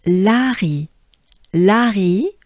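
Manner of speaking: "Larry" with a French throaty R. Shown as a demonstration. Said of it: "with a French accent".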